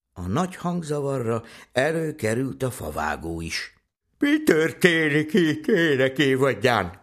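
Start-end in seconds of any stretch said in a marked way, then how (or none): 4.2-7.0 loud, slow, raised-pitch bewildered question; drawn-out, higher voice of a roused father; woken woodcutter asking. Acted. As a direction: demand slowly what all the noise is. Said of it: "s álmatag szemeit törölgette".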